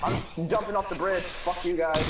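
normal talking voice

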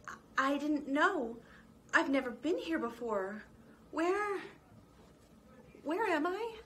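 high, small, unsure mouse; timid mouse voice. A narrator's reading. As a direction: ask shyly, a little lost. Mona timid and lost.

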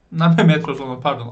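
ordinary delivery

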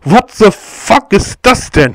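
loud, high, slow disbelieving cry; shocked outburst. Real, not acted. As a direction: shout it out in disbelief. Shocked at the garden's state.

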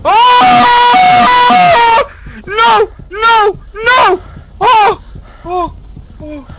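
high frantic yelling while fleeing; being chased. Real, not acted. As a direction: shout in panic as it chases you. Monster chasing him in game.

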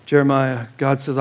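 normal talking voice

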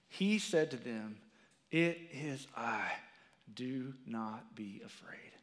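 low, soft and reverent, the words weighed; solemn scripture reading. Acted. Preacher reading Jesus' words to congregation, hushed and solemn. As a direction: read it like holy writ. Reads Gospel verse aloud.

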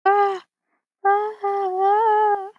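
string of high "ah" cries; freaked-out yelps. Real, not acted. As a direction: yelp high, over and over, freaking out. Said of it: "I don't know why I'm freaking out".

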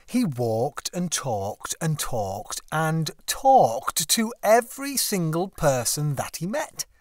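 ordinary delivery, nothing marked

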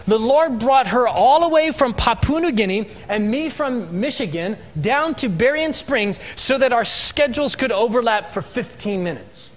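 ordinary delivery

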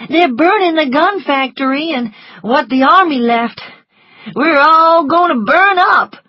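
high, loud wail of alarm; frightened servant crying out the news; panicked Prissy. Acted.

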